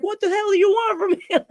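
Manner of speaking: very high-pitched mock outburst; startled irritation. James rebuffing the fan, startled and irritated. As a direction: imitate a coworker caught off guard.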